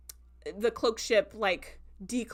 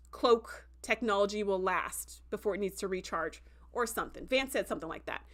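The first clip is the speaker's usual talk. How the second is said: ordinary delivery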